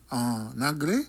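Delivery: ordinary talk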